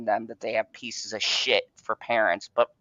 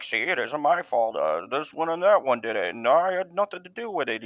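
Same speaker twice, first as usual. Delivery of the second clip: mock blame-shifting excuse. Mimicking Baldwin shifting the blame.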